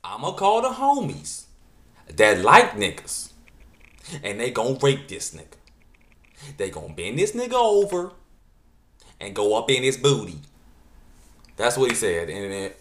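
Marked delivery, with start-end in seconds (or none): 0.0-10.4 loud exaggerated [name] voice; [name] impression. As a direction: ham up a thick California accent. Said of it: "I'm gonna try to do my [name] impersonation".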